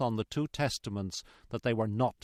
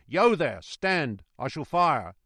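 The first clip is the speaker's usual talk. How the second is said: loud, raised warning shout; roared challenge; 3 semitones higher, 6 dB louder, slower than usual. A narrator's reading. Guard challenging the unseen rider, loud roar. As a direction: challenge the stranger at full voice. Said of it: "as loud as he could roar".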